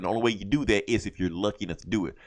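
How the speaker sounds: normal talking voice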